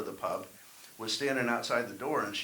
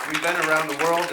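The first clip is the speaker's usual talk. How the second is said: much louder, higher brag to the crowd; proud loud boast; 5 semitones higher, 12 dB louder than usual. Real, not acted. Dad boasting to the crowd about his travels, loud and proud. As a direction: announce it like a big proud feat.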